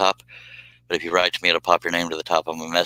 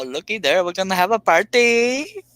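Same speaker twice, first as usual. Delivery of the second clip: much higher, sung-out party announcement; excited sing-song; 12 semitones higher than usual. Real, not acted. Host cheering as another guest joins, excited high sing-song. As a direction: sing it out, thrilled about the crowd.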